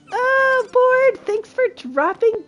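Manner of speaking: high, bright-eyed cry of welcome; delighted greeting. Real, not acted. Streamer greets a viewer dropping by, delighted. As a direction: pitch leaps up in happy recognition.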